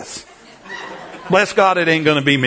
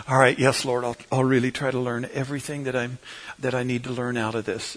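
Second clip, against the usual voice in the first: softer, fading resigned prayer under the breath; inner prayer; 5 semitones lower, 8 dB quieter than usual. Retold or imitated. Stuck passenger praying inwardly for patience, soft and fading. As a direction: pray to yourself, trailing away. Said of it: "I'm sitting there thinking".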